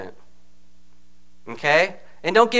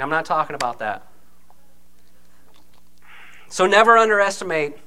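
ordinary talk, nothing marked

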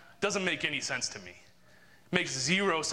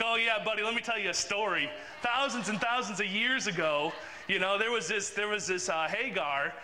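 sarcastic; 5 semitones higher than usual. Retold or imitated. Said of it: "I was kinda being sarcastic with the guy".

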